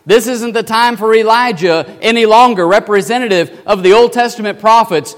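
God firmly setting the listeners straight; voice from heaven. Acted. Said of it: "A voice from heaven comes down and says".

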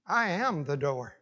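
"I am" said low-key and falling; understated declaration. Shown as a demonstration. Preacher imagining the Lord's later words, understated declaration. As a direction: let "I am the door" settle softly downward. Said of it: "Or he might have said later".

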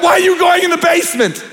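high mock-exasperated plea; high-pitched mock disbelief at a horror movie; yelling at the screen. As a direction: shout at the characters on screen.

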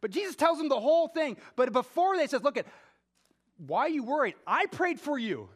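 preacher voicing Jesus reassuring Peter; fervent preaching. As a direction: press the comfort home. Preaching at full force.

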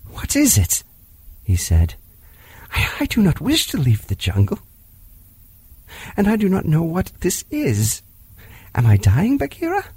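put-on a boy crying for the first time; tearful bewilderment. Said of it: "and sobbed".

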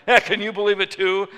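loud, high indignant appeal; rallying complaint. Retold or imitated. Customer turns to the people behind him, loud rallying complaint. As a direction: call out for others to share the outrage. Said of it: "get the people behind him".

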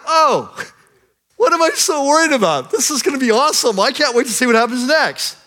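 high, loud, thrilled exclamation; excited realization. Retold or imitated. Servant sees heaven's army, bursting with excitement. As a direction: burst out with sudden delight.

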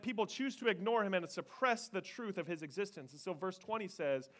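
ordinary talk, nothing marked

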